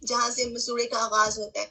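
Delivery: ordinary talk, nothing marked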